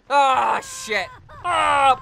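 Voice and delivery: loud, high shout of shock; startled shout. Real, not acted. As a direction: jump at the sudden danger. Startled in-game danger.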